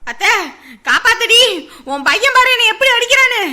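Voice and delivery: loud pleading shout; crying for help. Acted. Daughter-in-law calling her mother-in-law for help, loud plea.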